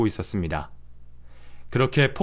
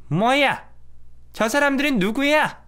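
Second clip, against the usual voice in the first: high, sharp startled mother-in-law; suddenly taken aback, demanding answers; 12 semitones higher than usual. Acted. Mother-in-law sees the cleaners. (깜짝 놀라 따지는 시어머니)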